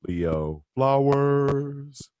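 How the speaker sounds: very slow, stretched self-introduction; drawn-out announcer. Acted. Host introducing himself to listeners, drawn-out announcer style. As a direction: draw out the name, holding the last word long.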